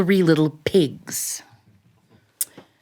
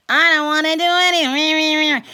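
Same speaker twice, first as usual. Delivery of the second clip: higher, whiny grumble of a sulky inner voice; cranky inner voice; 9 semitones higher than usual. Retold or imitated. Storyteller voicing her cranky inner self, higher and whiny. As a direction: pout it out like a cranky little voice on your shoulder. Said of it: "that cranky person who sits on your shoulder".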